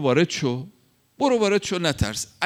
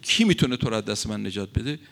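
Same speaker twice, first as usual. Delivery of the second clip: normal talking voice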